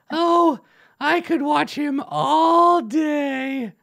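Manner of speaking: high, slow, dreamy goblin sigh; high lovesick goblin voice; swooning goblin. Acted. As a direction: drawl it out, lovestruck. Powdered-wig goblin swooning.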